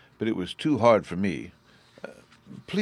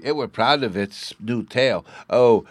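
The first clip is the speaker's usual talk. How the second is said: put-on louder, higher boastful child voice; proud little boy; 5 semitones higher, 10 dB louder than usual. A narrator's reading.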